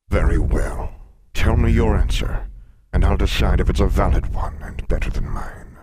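loud, booming monster voice; riddling sphinx. Acted. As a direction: pose the riddle as a big beast.

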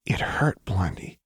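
faint, breath-thin hurt voice; hollow. A narrator's reading. Jinx confiding her hurt to Lux, hollow. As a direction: say it hollowly, fading to a whisper. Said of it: "Jinx said hullily".

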